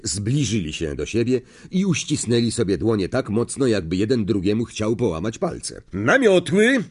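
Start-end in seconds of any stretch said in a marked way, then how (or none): 5.9-6.8 higher called command. Acted. Madam Hooch to the players, calling out. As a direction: call it across the pitch. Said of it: "zawołała pani Hooch".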